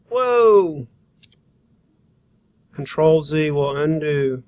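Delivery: ordinary talk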